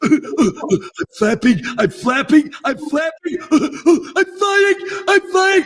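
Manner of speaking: very high, excited dopey goof; dopey character. Acted. A dopey creature thrilled to be flying, very high silly giggly voice. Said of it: "the master of that kind of character".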